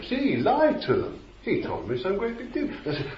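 normal talking voice

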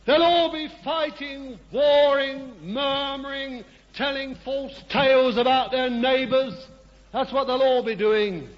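high, loud ringing preaching; preacher in full flight, high and loud; full-force preaching. Real, not acted. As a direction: cry it out over the congregation.

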